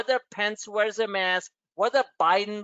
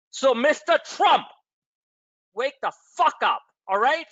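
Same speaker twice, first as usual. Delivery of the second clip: loud, raised, high call-out; shouting at Trump; 6 semitones higher, 4 dB louder than usual. Real, not acted. Host telling Trump to wake up, loud and angry. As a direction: yell it straight at the president.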